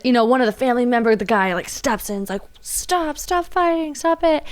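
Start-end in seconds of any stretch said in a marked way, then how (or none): 2.6-4.4 high, urgent mock plea; raised pitch, insistent but not shouted; imitated pleading to stop. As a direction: act out a relative breaking up a fight. Relative steps into fight.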